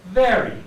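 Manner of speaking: a clear, buzzing v on "Very"; careful v demo. Shown as a demonstration. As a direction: bite the lip lightly and buzz the v in "Very". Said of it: "put your teeth in front of your lower lips".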